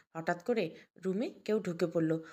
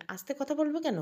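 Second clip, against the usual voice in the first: sharp, much higher outraged question; indignant retort; 7 semitones higher than usual. Acted. Son snapping back at mother, high and indignant. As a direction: push back hard at being hushed. Mahin argues with his mother.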